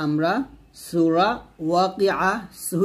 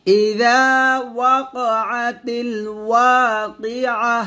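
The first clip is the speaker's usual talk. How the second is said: slow melodic الواقعة, drawn out; recite for repetition; 5 semitones higher, 4 dB louder, much slower than usual. Shown as a demonstration. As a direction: model it for students to repeat. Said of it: "আমার সাথে বলেন". (শিক্ষার্থীদের জন্য ধীরে তিলাওয়াত)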